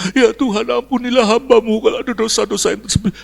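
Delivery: high, fast, gabbled plea; mock pious prayer. Visitor praying over someone gravely sick, mock fervent pleading. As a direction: imitate an overeager intercessor rattling off prayer.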